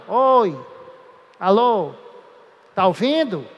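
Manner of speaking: calling into a silent phone; testing the line. Shown as a demonstration. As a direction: call out oi alô to check the line. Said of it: "Isso é testar o canal". (chamando no telefone, alô, tá ouvindo)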